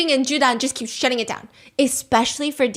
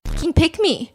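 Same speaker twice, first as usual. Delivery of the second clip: annoyed retort; 6 semitones higher, 6 dB louder than usual. Retold or imitated. Voicing annoyed viewers.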